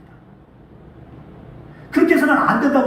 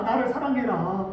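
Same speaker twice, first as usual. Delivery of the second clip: "나를 사랑해라" repeated much softer and slower, 나를 rising; soft plea; 8 dB quieter than usual. Acted. Preacher voicing God to the congregation, soft slow plea. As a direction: plead it quietly, almost to yourself. Preacher voices God's command.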